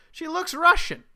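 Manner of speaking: high, loud mocking quote; exaggerated high-pitched imitation of a film character; mock movie heroine. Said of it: "and she goes".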